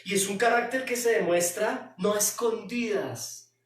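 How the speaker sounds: ordinary talk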